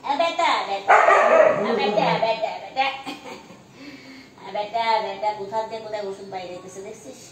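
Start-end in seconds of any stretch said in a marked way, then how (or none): 0.0-5.3 loud, raised call to the dog; calling to a pet. Real, not acted. Woman calling her injured dog, calling to a pet. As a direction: call the animal over and over, insistent.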